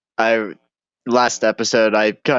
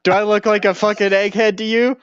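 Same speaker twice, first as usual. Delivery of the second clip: high-pitched defensive protest; mock indignant; 8 semitones higher than usual. Stoner refusing to work out the idea, mock indignant. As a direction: push back as if absurdly overestimated.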